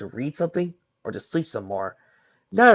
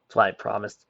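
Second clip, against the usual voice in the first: usual speaking voice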